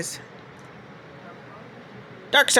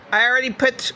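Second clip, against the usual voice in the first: usual speaking voice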